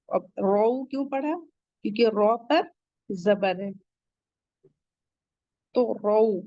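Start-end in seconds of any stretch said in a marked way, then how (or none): none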